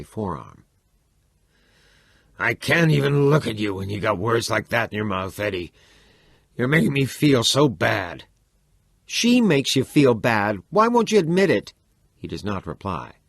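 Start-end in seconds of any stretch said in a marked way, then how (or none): 2.4-8.2 loud, wounded complaint; an aging father stung by his son; hurt father. Acted. Father tells his son he feels hurt, loud and wounded. 9.1-11.7 angry demand. Acted. Chico demands his father admit it, rising anger.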